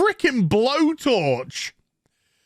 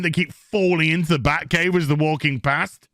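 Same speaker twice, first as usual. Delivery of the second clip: ordinary delivery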